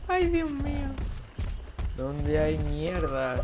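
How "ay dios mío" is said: Dios mío squeaked high; high exasperated cry. Real, not acted. Frustrated while searching.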